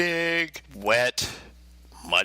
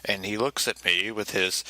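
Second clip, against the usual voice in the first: ordinary talk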